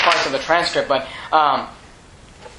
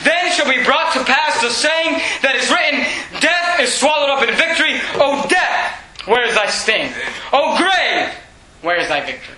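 preaching at full force; 6 semitones higher than usual. Acted. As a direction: proclaim it like a triumphant preacher. Preacher reading scripture aloud.

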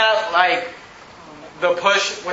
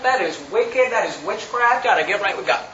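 ordinary talk

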